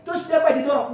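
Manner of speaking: high, loud follow-up grilling; acted-out indignant friend; angry questioning. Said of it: "Mereka sudah mau marah".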